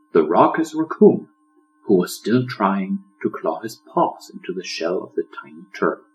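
ordinary delivery, nothing marked

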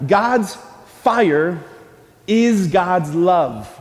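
slow, spaced-out phrase for the congregation; slow and deliberate. Shown as a demonstration. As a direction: lay it out word by word so they can echo. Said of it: "Repeat after me".

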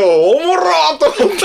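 high, laughing through the words. Real, not acted. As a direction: crack up while telling it. Laughing while telling.

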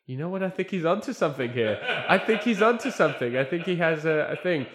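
excited realisation. Retold or imitated. Producer's eureka realisation.

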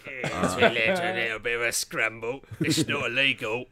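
loud cheery Cockney; hearty London bloke accent. Pretend. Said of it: "record the whole podcast like this".

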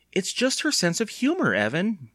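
high-pitched, tentative reassurance; uncertain. A narrator's reading. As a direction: soothe without quite believing it. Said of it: "said uncertainly".

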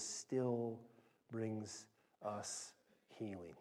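slow, softening to a whisper-quiet close; fading to a hush. Real, not acted. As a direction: let it sink in, dropping to near silence. Sermon point fading to hush.

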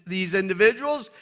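ordinary talk, nothing marked

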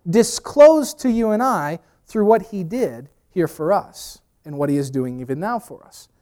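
ordinary talk, nothing marked

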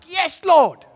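high, loud, eager exclamation; excited. Acted. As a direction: burst out thrilled to be called. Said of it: "he, he is excited".

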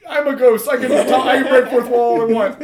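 lightly voiced high ghost; cheeky spirit boasting; nervous ghost. Ghost to the listeners, lightly voiced high. Ghost breaks fourth wall.

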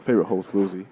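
low, soft hip hop host sign-off; cool radio-host murmur, hip hop style. Pretend. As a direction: put on a laid-back, low hip hop voice. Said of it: "my hip hop voice on".

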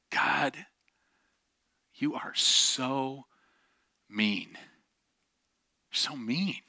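slow, tearful accusation fading to whisper; retold hurt, breathy at the end; ugly crying, re-enacted. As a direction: drag the words out, then whisper them. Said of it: "ugly crying".

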